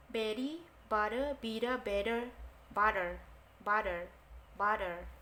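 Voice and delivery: T in "butter" and "better" softened into a quick D, American style; American flapped T. Shown as a demonstration. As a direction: say "bitter" with the T flapped to a soft D. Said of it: "soft D gitu". (T Amerika dibaca seperti D lembut)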